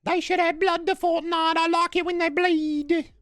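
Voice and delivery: very high little British orphan; Chippy Chimney Swift. Acted. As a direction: give gaming feedback in a squeaky waif voice. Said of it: "I don't like Chippy anymore".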